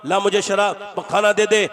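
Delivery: put-on rough drunk yelling for food; drunken demand. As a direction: shout the demand at his mother. Drunk son demands food in Ramadan.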